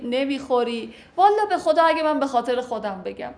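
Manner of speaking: ordinary talk, nothing marked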